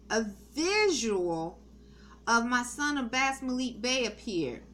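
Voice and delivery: ordinary talk, nothing marked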